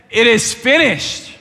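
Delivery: slow, loud, high proclamation; quoted yell. Retold or imitated. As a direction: draw out the quoted cry with force. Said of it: "He yells out".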